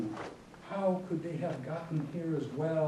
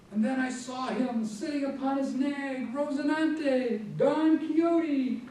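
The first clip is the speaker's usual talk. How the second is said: raised, high-pitched storytelling in wonder; amazed narration; 10 semitones higher, 3 dB louder than usual. A narrator's reading. Narrator suddenly sights Don Quixote on the moon, high-pitched amazement. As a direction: let the pitch soar on the astonishing sighting.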